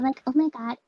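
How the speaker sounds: put-on a light Valley Girl lilt; breezy Valley Girl gush. Shown as a demonstration. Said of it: "We have Valley Girl [name]".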